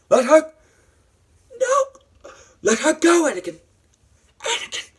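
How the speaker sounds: high, loud frantic yelling; hammed-up urgent cries; desperate acted plea. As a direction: beg and shout in theatrical alarm. Acts out Obi-Wan's desperate plea.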